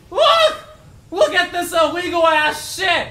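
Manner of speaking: high, loud burst of outrage; indignant yell, starting very high; outraged shouting. Real, not acted. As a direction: shout for everyone to witness the cheating. Outraged at unfair game move.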